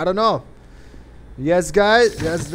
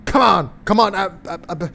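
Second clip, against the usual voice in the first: ordinary talk, nothing marked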